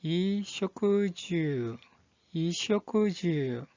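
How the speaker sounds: 이쇼쿠주 said very slowly, vowels drawn out; pronunciation demo. Shown as a demonstration. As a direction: model 이쇼쿠주 slowly for learners, syllable by syllable. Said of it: "이 한자의 발음은".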